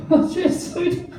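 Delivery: very high-pitched, pleased dog; delighted dog voice. Acted. Dog meeting another dog, high delighted voice. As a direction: squeal your approval like a happy dog.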